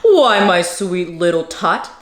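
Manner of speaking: low, sugary false Santa voice; lying fake Santa. Grinch lying to Cindy Lou, low and sugary. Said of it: "the fake Santa Claus lied".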